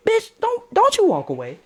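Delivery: falsetto mock outrage dropping back down; mad. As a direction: start in a squeaky high protest, then come down. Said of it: "she made me mad".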